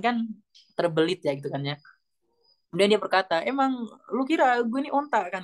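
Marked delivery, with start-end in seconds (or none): 3.5-5.4 higher, offended retort of the wife; scorned wife fires back, indignant and higher. Retold or imitated. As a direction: snap back like an insulted woman. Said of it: "kata si istrinya itu".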